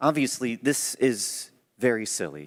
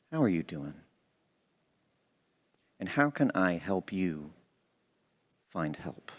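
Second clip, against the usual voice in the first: soft caring question; 3 semitones lower, 5 dB quieter than usual. Shown as a demonstration. Gentle check-in, softer.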